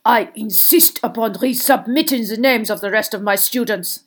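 forceful foreign headmaster demand; hard, emphatic insistence; insistent, unctuousness dropped. A narrator's reading. Karkaroff demanding to resubmit names, insistently. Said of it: "He had dropped his unctuous tone".

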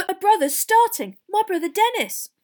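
put-on loud, high, breathless boy; highly excited. Boy telling his hero about his brother, excited. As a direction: announce it bursting with pride. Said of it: "a highly excited, breathless voice".

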